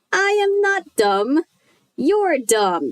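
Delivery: high, slow squeaky puppet voice; squeaky dummy voice. A narrator's reading. Dummy mocking Chris in a slow squeaky voice. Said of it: "in a high, squeaky voice".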